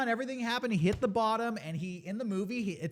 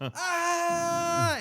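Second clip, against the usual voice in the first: high, loud shout of "Ah!"; imitated scream; 11 semitones higher, 4 dB louder than usual. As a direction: yell like you can't stop screaming. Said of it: "I couldn't stop screaming".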